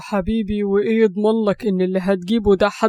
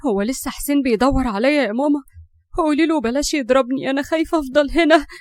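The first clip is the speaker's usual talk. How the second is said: put-on higher, frightened girl's voice; a scared young girl asking her mother; low voice; 5 semitones higher than usual. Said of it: "وقالت بصوت واطي". (بصوت واطي لبنت خايفة)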